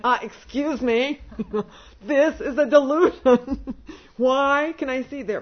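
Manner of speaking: loud, laughing disbelief. Retold or imitated. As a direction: laugh through the words at the absurdity. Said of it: "And I started laughing, going".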